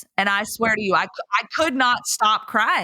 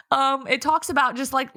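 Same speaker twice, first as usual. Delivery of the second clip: higher, tearful voice; holding back tears; 6 semitones higher than usual. Real, not acted. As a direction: try to keep talking through real tears. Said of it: "I'm crying right now".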